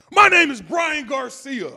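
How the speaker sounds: loud, high, drawn-out irate customer; irate phone customer; angry caller. Acted. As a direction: shout your name like a furious caller. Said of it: "I'm pissed the fuck off".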